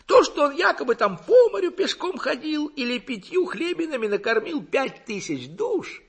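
high, loud, gurgling orator's voice; pompous village lecturer burbling away at high pitch. A narrator's reading. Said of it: "заговорил каким-то булькающим голосом".

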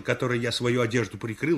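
usual speaking voice

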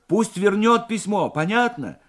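high, loud, urgent instruction; insistent. A narrator's reading. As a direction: make sure the operator gets it. Morev insists operator pass message on. (настойчиво, взволнованно)

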